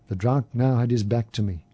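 ordinary delivery, nothing marked

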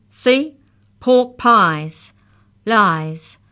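loud, triumphant accusing; loud accusation. A narrator's reading. Molly throws the lies at Mallory, loudly accusing. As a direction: hammer each word out loudly. Molars crow over Mallory's omission.

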